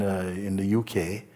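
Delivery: ordinary talk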